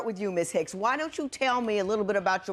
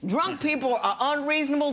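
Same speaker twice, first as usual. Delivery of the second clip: exasperated; 5 semitones higher than usual. Real, not acted. Judge scolding exasperated litigant.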